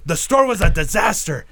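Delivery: imitated angry boss, high and loud; Sam threatening. Boss berating employee about the store, loud mock outrage. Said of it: "he's already threatening".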